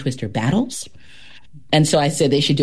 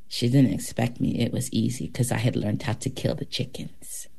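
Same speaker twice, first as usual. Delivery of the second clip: low, soft, matter-of-fact confession; quiet story ending; 5 semitones lower, 6 dB quieter than usual. Acted. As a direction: deliver the last line low and quiet. Quoting the story's heroine at its end.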